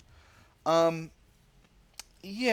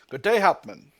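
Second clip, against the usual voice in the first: a touch of a British officer, louder; British officer's German; 5 semitones higher, 11 dB louder than usual. Acted. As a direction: greet him with stiff schoolboy German. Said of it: "he says in passable German".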